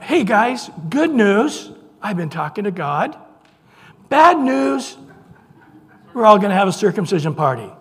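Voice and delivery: high, bright announcing to the guys; hammed-up good news then bad news; Abraham breaking news to his household, playfully; mock cheerful Abraham.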